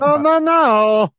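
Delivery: high, loud, drawn-out protest; mock refusal. Playing someone who won't walk, hammed-up loud refusal. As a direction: wail the no's in exaggerated protest.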